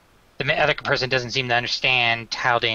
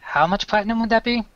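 NPC voice; 9 semitones higher than usual. Acted. Caven voiced by GM.

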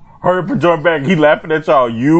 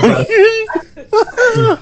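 shrieking laugh. Real, not acted. Gamer cracking up at his friend's crude rhyme, shrieking. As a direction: burst out in a screaming laugh.

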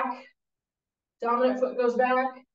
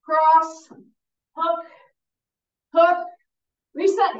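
calling punches loud; 4 semitones higher, 6 dB louder, slower than usual. Real, not acted. Boxing coach calling punches.

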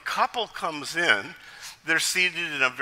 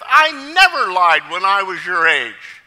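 loud, indignant preacher boast; mock sermon climax; 3 semitones higher, 9 dB louder than usual. Pastor ends his sidewalk sermon to boys, loud and self-righteous. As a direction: proclaim it with mock righteous force. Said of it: "he breaks into a sermon".

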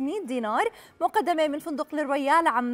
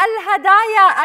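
booming prize announcer; 4 semitones higher, 9 dB louder, slower than usual. Real, not acted. Prize announcement on game show.

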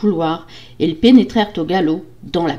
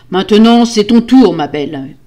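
loud threat easing on the last words; menacing yell; 3 semitones higher, 9 dB louder than usual. A narrator's reading. Husband condemning his wife, menacing yell. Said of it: "hurla-t-il".

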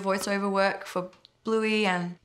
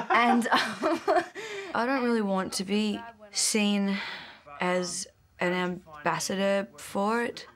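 normal talking voice

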